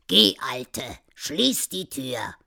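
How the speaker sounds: put-on low, slow old-man command; old husband's order. Old man to his wife about the door, low gruff order.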